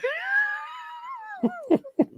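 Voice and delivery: high squealing laugh; high-pitched real laughter. Real, not acted. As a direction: crack up in a high wheeze. Laughing at the voices.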